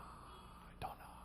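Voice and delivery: slow unvoiced whisper; whispered I don't know. Guji whispering his koan while sitting in meditation. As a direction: breathe it inward, slowly.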